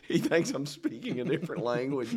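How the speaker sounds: usual speaking voice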